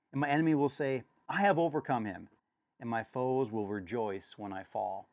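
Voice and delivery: loud, pleading psalm reading; scripture reading. Acted. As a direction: beg God for an answer.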